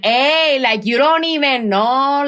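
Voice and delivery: drawn-out, sing-song sassy persona; sassy stage character. Shown as a demonstration. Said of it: "she talks like this a little bit".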